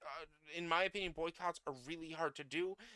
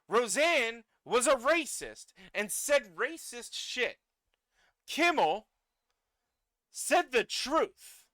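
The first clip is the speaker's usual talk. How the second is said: slow, hammered-out angry shout, higher and louder; furious; 7 semitones higher, 7 dB louder, slower than usual. Real, not acted. Ranting to viewers about Roseanne and Kimmel, furious shout. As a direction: spit each word out in real outrage.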